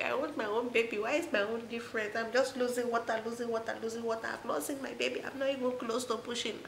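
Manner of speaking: high, whiny mock sob of a frustrated mother; half-playful wail about only losing water; imitating her own tearful whining in labor; mock crying complaint. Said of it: "I was not crying like".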